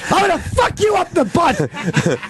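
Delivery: high, drawn-out joke threat; mock yelling. Host heckling a media icon, hammed-up yell. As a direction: holler it far up in pitch, slow and over the top. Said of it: "I'm just yelling at a media icon".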